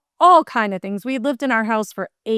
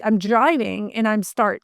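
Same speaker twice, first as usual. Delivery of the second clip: normal talking voice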